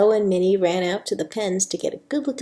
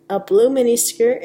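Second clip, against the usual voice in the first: plain reading voice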